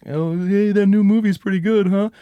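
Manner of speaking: high, chummy talk-show voice; cheery host. Acted. TV host chatting to the sleepy man, high and chummy. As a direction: chat up the viewer.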